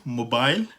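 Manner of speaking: मोबाइल said slowly as "mo-bile"; British mobile. Shown as a demonstration. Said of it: "अब कितना ज़्यादा फ़र्क है".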